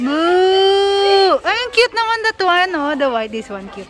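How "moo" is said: playful cow moo. Retold or imitated. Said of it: "What does the cow says?".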